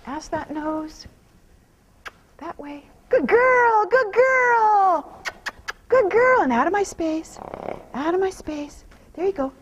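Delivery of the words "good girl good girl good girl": high, loud bursting praise; excited praise. Real, not acted. Trainer rewarding the mare, high happy praise. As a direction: reward her with a happy cheer.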